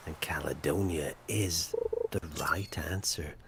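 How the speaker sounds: hushed whisper of the answer; a dramatic whispered quiz answer; whispered reveal. Acted.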